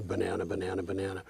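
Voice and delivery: low, soft, rapid mechanical repetition; said without listening. Shown as a demonstration. Lecturer showing how to repeat a word unthinkingly, quick and low. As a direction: rattle it off under the voice, paying no attention. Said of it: "just say the word without listening".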